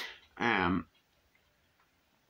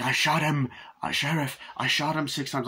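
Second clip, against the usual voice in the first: agitated Loomis; 5 semitones higher than usual. Retold or imitated. Loomis tells the sheriff he shot him, agitated. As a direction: play a rattled doctor protesting.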